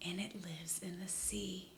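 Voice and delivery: hushed aside; whisper. Acted. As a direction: whisper it to the children.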